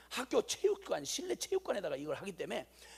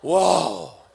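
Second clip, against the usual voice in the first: amazed wow; 14 dB louder than usual. Real, not acted. Amazement at the result.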